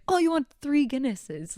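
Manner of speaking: put-on high-pitched, drawn-out Irish accent; slow, incredulous Irish bartender echoing an order; mock Irish bartender. Irish bartender teasing American tourists over their order, mock Irish accent. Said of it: "And then Irish bartender would be like".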